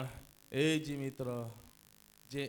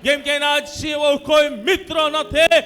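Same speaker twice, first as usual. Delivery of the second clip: loud, exultant hallelujah shouts with long vowels; full-force preaching; 12 semitones higher, 18 dB louder, slower than usual. Real, not acted. Preacher praising Jesus as the only friend, shouted full-force preaching. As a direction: thunder the praise over the congregation.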